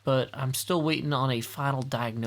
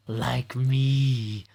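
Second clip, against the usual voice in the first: Walt Brisby voice; 3 semitones lower than usual. Pretend. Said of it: "That accent is, like, from the Walt Brisby voice".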